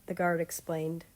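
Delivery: usual speaking voice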